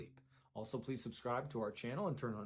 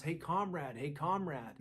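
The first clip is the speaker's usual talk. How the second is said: higher-pitched, restrained call-out; mild mock yell; 4 semitones higher than usual. Storyteller voices the dreamer hailing his lost friend, mild yell. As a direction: imitate calling to a friend without really shouting. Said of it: "and so he yells".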